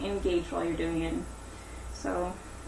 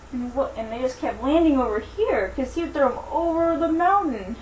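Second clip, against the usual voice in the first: animated storytelling, higher than chat; story narration; 5 semitones higher, 4 dB louder than usual. Acted. Teacher telling Daisy and the giant to children, animated story narration.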